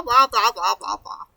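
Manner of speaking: ordinary talk, nothing marked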